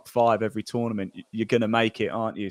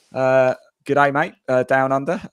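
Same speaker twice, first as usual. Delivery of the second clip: a hammed-up Aussie "good day, mate", louder; a jokey, not very good Australian accent greeting the family down under; mock Australian accent; 3 semitones higher, 6 dB louder than usual. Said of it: "Tonight's a show of accents, uh, not very good ones".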